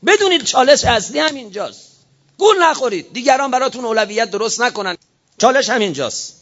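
high, emphatic warning from the pulpit; urgent preaching. Real, not acted. Preacher warning listeners not to be fooled, urgent and high.

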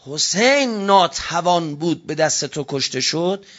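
ordinary talk

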